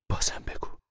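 hushed plea; whisper. Acted. Mother begging son to go on, whispering. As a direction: breathe the request.

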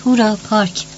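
lightly voiced gleeful cheer; a mild mock shout of delight; excited child's cry. A narrator's reading. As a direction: cheer like a little girl, without much volume. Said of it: "فریاد زد".

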